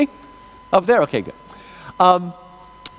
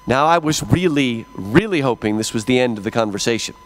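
weary deadpan; 8 semitones lower than usual. Real, not acted. Repeated aside, lower and quieter.

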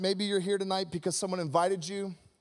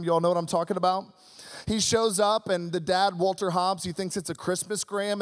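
ordinary talk

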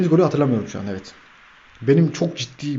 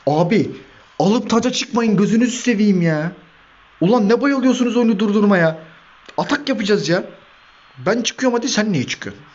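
higher, louder complaining whine at the players; exasperated pleading; 7 semitones higher, 3 dB louder than usual. Real, not acted. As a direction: beg them to stop kicking it out. (oyunculara sitem eden yüksek sesli yakınma)

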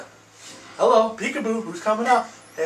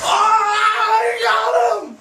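high, drawn-out, loud cheer; excited yell; 3 dB louder, much slower than usual. Real, not acted. Gamer landing a kill, real excited high yell. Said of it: "I don't know why I got excited".

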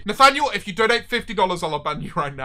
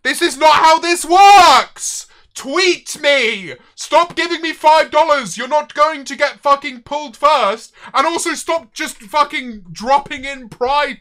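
loud, raised shouted telling-off; exasperated rant; 4 semitones higher, 5 dB louder, slower than usual. Real, not acted. Streamer scolding viewers who pay to jump the queue, exasperated shouting. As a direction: lay down the rules at a shout.

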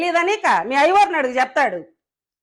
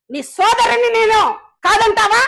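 indignant challenge; 5 semitones higher, 3 dB louder than usual. Real, not acted. Angry challenge to rival politician.

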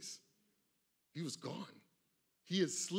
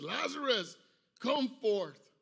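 high ringing call to Lazarus, louder; 6 semitones higher, 5 dB louder, slower than usual. Retold or imitated. Jesus calling Lazarus from the tomb, a loud ringing cry. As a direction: call the dead man out of the tomb. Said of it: "he cried out with a loud voice".

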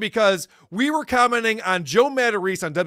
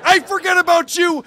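mock outrage; 6 semitones higher than usual. Real, not acted. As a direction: tear into him with comic fury. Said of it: "ranting at Mike".

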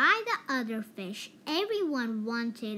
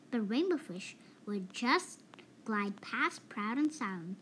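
usual speaking voice

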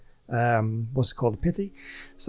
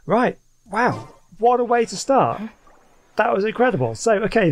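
high, louder exclaiming; excited; 7 semitones higher, 4 dB louder than usual. Real, not acted. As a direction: still buzzing from the pulls. After the great pulls.

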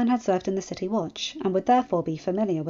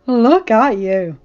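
slow, louder, beaming; huge smile; 9 dB louder, much slower than usual. Acted. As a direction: grin through the words. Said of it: "John said with a huge smile".

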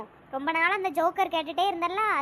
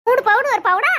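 angry outburst; 8 dB louder than usual. Acted. Mother snaps at daughter-in-law.

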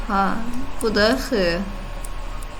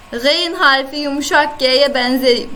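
normal talking voice

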